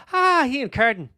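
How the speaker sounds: high, playful mock Irish accent; hammed-up Irish greeting; attempted Irish accent. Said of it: "The accent fell apart there".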